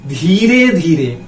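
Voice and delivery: धीरे धीरे stretched long and slow; slow, drawn-out धीरे धीरे; drawn out slowly. Shown as a demonstration. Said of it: "कैसे चल रहे हैं?".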